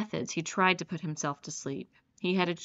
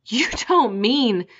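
loud, higher, slow horrified cry; cried out; 5 semitones higher, 8 dB louder, slower than usual. A narrator's reading. Rainsford to the general, loud horrified cry. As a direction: blurt it out aghast. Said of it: "cried Rainsford".

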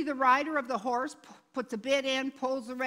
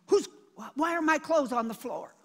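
louder, higher, stammered baffled question; mock bewilderment; 4 semitones higher, 4 dB louder than usual. Retelling someone waking up confused in a strange bed, mock bewilderment. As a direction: act out a startled, confused waking.

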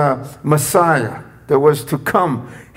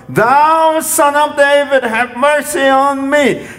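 yelling plea; 8 semitones higher than usual. Retold or imitated. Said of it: "he just yelled the more".

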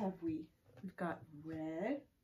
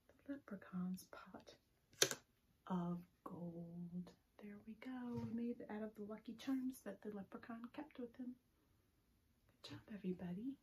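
soft whispered wonder; hushed reveal; 3 semitones higher, 6 dB quieter than usual. Acted. Teacher revealing the pot of gold to the kids, hushed. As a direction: whisper it like a secret treasure. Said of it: "hushed reveal to children".

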